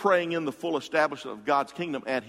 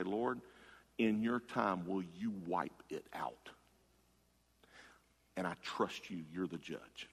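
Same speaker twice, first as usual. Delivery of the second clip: much softer and lower, fading to a hush; hushed trusting prayer; 7 semitones lower, 12 dB quieter than usual. Shown as a demonstration. Preacher modelling a trusting prayer, hushed and lowered. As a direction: hand it over to God quietly.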